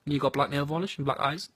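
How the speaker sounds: usual speaking voice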